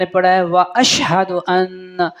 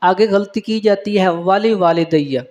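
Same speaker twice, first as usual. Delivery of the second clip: ordinary talk, nothing marked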